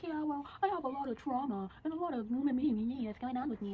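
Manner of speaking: high, drawn-out mocking whine; whiny self-pity. Guy pleading his trauma to her, mock whiny. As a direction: mimic him moaning about his woes, stretching the words.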